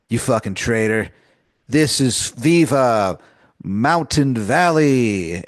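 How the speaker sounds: slow, rising rallying cry; defiant declaration. Acted. Rebel cursing the traitor minister before firing, slow and proud. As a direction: drag out Viva Mountain Valley like a battle slogan. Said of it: "look at him in the eyes and you go".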